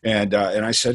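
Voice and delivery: ordinary talk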